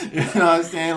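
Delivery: laughing through the question. Real, not acted. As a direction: keep laughing as you ask. Laughs at own joke.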